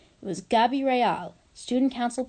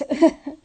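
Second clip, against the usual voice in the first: brief embarrassed laugh; short laugh; 7 semitones higher, 8 dB louder than usual. Real, not acted. Reader laughing at her own Spanish, a quick laugh. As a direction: let out a quick laugh at yourself. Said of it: "Apologies for that".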